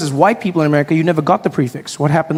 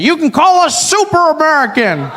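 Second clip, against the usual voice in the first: mock shout; 8 semitones higher than usual. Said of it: "a gentleman shouts it out".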